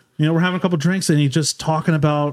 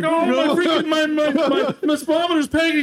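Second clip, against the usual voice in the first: much higher, mock-panicked stammer; panicked Pat; 11 semitones higher than usual. Telling the race story, voices Pat in mock panic, much higher. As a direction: squeak it out as if the car is running away.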